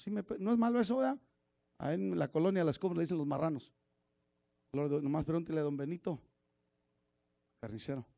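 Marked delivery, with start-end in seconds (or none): none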